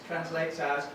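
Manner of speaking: ordinary talk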